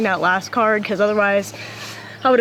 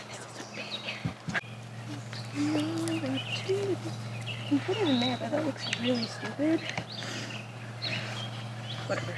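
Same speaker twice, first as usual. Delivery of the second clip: whispering; 5 semitones higher, 14 dB quieter than usual. Real, not acted. Hiding the idol secretly.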